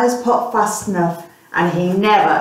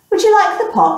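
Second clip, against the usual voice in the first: woman's offer; 11 semitones higher, 3 dB louder, faster than usual. Acted. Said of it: "said the woman".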